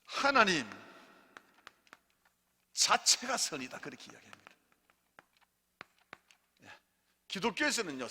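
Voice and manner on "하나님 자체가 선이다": louder, higher preacher line to repeat; 회중이 따라 하도록 크게 외치는 선포; call and response. Shown as a demonstration. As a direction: proclaim it slowly for the church to echo. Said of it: "여러분 따라합니다".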